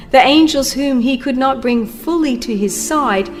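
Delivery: ordinary talk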